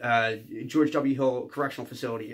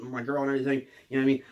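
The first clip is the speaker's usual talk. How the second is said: usual speaking voice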